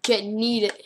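usual speaking voice

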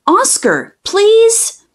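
please lifted high, begging; a childish high plea; high pleading. Acted. Child begging her dog to talk, high pleading please.

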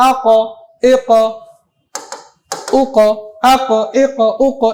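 আ ক, এ ক, উ ক with the final q kicked; qalqalah bounce. Shown as a demonstration. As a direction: separate syllables, each q rebounding in a short echo. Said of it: "ধাক্কা দিচ্ছি".

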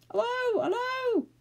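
very high, hooting yell; high, ringing call; excited yell. A narrator's reading. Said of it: "yelled Moonface".

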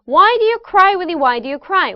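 sing-song chant, high opening WHY stepping down to a falling cry; rhythmic tongue twister. Shown as a demonstration. Coach leads students in tongue twister, lively rhythmic chant. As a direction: bounce "Why do you cry" along, starting high and dropping. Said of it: "이 리듬".

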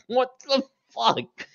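high, slow stunned mutual reaction; jaw-dropped disbelief. Acted. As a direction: act out dumbfounded shock, drawn out and high. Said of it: "have his jaw dropped right now".

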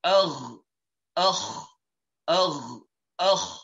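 demonstrating the incorrect rough noise; wrong rough throat sound. Shown as a demonstration. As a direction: rattle the back of the tongue, demonstrating the mistake. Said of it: "in the manner you will hear very soon".

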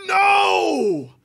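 huge high shouted no; hammed-up scream. [name] learns Draco died, screaming in mock horror. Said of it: "I screamed".

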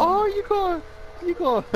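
high mock voice. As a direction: squeak it out like the movie guy. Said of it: "He said".

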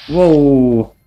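surprised whoa. Real, not acted. Surprised by armed stranger.

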